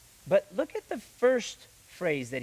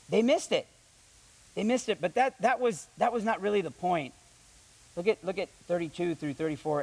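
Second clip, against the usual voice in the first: ordinary talk, nothing marked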